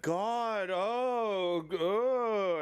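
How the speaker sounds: high, strained mock groan of dismay; mock anguished groan. Streamer mocking the dog owner, high strained groan. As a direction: overplay the owner's pained oh, high and loud. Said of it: "And he's like".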